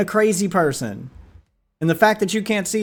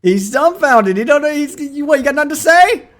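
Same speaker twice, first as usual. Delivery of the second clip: louder, much higher jeering; high mocking taunt; 9 semitones higher, 6 dB louder than usual. Real, not acted. Commentator jeers at the speechless streamer, high and loud. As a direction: needle him for his silence.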